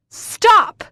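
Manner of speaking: very loud, high scream; desperate shriek. Acted. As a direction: scream stop while thrashing. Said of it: "I thrash".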